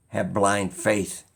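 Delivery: ordinary delivery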